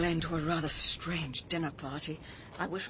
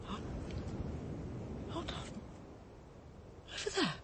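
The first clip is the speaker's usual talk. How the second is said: higher and hushed, startled; a startled, higher whisper at a light in the ruins; sudden alarm; 9 semitones higher, 8 dB quieter than usual. Acted. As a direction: catch your breath as you spot it.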